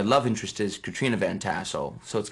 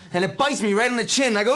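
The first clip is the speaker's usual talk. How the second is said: ordinary delivery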